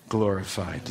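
ordinary talk, nothing marked

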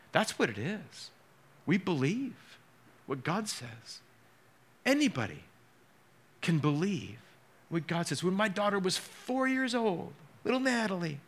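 normal talking voice

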